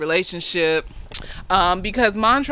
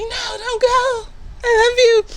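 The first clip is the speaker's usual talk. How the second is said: very high mock whimper; tearful pleading; 3 dB louder than usual. Montrose grabs Sammy's arm to stop him, tearfully begging. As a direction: whine it like a hammed-up tearful lover. Acted plea, grabbing lover's arm.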